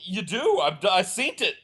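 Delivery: insist, flustered and high; sheepish pleading that keeps climbing; high defensive insisting. Retold or imitated. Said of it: "And I'm like".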